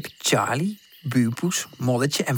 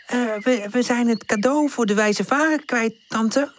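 higher, a hint of tearful child; small voice close to crying; near tears; 8 semitones higher than usual. Pip confessing to tante, near tears. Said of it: "Ze moeten bijna van huilen".

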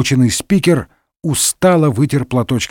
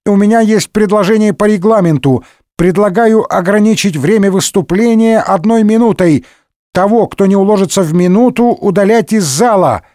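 higher-pitched congested proposal; stuffed-nose voice; 6 semitones higher than usual. A narrator's reading. Member proposing a time limit, head-cold voice. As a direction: make a motion through a blocked nose. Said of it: "раздался чей-то насморочный голос".

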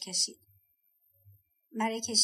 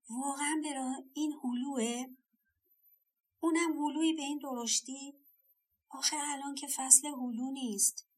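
higher-pitched, wondering self-talk; amazed disbelief; 4 semitones higher than usual. A narrator's reading. Old woman talking to herself about an out-of-season peach, amazed. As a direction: question it in mild amazement.

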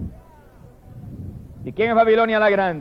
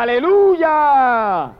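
raised, long drawn-out praise cry; shouted hallelujah; 4 semitones higher, 4 dB louder, much slower than usual. Retold or imitated. Preacher voices the great multitude in heaven, drawn-out shout. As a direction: hold the word out as a rising-then-falling shout. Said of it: "y gritaba".

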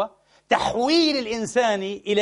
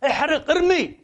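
mocking laugh; 8 semitones higher, 4 dB louder, slower than usual. Mocking killers burning comrade.

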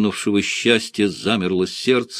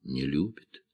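quiet, sad admission; тихо; 6 semitones lower, 9 dB quieter than usual. A narrator's reading. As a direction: let it drop softly. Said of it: "вдруг тихо произнесла она". (тихо и грустно)

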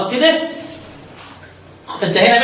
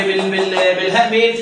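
ordinary talk

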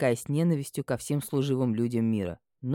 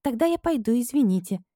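babbling; 9 semitones higher than usual. A narrator's reading. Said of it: "пролепетала".

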